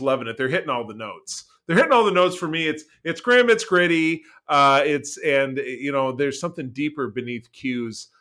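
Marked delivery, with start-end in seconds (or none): none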